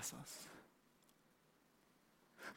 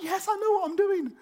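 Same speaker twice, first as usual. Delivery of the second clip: very high, loud elated cry; excited; 9 dB louder than usual. Retold or imitated. Joseph rejoicing in his gift, very high and loud. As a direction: burst with confident joy. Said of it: "so excited, like".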